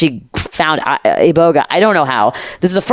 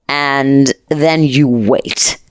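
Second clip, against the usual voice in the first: drawn-out, slow and hushed; slowly, as if the waiting itself were heavy; slow suspense; much slower than usual. Acted. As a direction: stretch every word to build dread. Dramatic pause before the trip.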